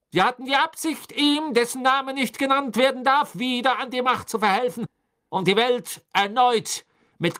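loud, high, relentless prosecution; barked accusation. A narrator's reading. Crouch bellows the charges at the accused, barking. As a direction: bark out the charges without letting up. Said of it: "bellte Mister Crouch".

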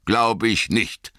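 slow, grim and doubting; a touch of dark sternness; stern wizard, unconvinced. Acted. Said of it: "sagte Gandalf finster".

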